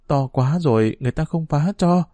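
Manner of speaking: usual speaking voice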